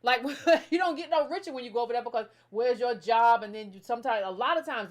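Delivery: usual speaking voice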